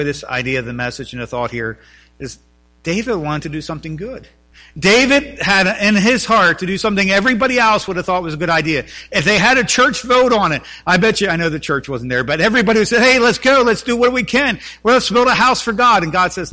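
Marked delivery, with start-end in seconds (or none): 4.8-13.0 rising, louder preaching. Acted. Pastor preaching to his congregation, building louder. 13.0-16.0 much higher, louder eager crowd voice; eager church crowd. Pretend. Churchgoers urging each other to build, eager and loud.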